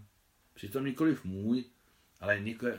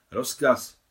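loud, higher "yes, sir"; military acknowledgement; 4 semitones higher, 10 dB louder than usual. A narrator's reading. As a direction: acknowledge the order like a saluting officer. General salutes the marshal's order.

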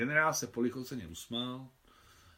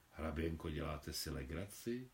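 a doubtful, lower and softer question with a smile; incredulous smile; 8 semitones lower, 10 dB quieter than usual. Acted. Governor asks the countess, low and softly disbelieving. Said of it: "s nedůvěřivým úsměvem".

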